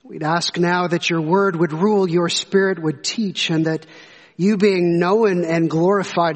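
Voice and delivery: low, measured prayer voice; public prayer. Real, not acted. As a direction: lead the room in reverent prayer, low-pitched. Opens with a prayer.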